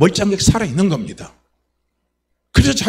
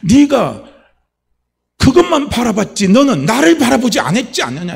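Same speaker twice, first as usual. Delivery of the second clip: loud, high, forceful reproach; raised voice, pressing the accusation; Holy Spirit's rebuke; 7 semitones higher, 7 dB louder than usual. Retold or imitated. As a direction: preach it as God confronting someone. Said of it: "성령께서 깨닫게 해주신 게".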